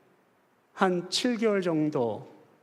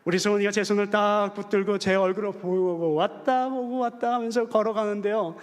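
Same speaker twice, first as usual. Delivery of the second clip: ordinary talk, nothing marked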